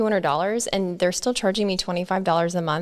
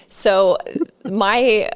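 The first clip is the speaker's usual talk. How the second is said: ordinary talk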